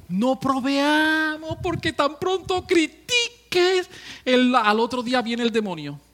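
high, loud, slow sermon peak; preaching at full force. Acted. Preacher warning the congregation about demons, full-force preaching. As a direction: hammer the warning home.

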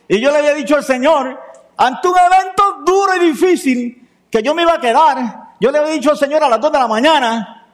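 fervent sermon peak, lifted high; preaching at full force. Acted. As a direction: proclaim it to the congregation. Preaching climax, raised voice.

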